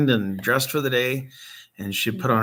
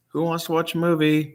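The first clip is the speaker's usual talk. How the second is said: higher, lightly voiced mom's invitation; mom calling kids; 7 semitones higher than usual. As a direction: imitate mom rounding up the kids, pitched up. Voicing Mom's movie-night invitation.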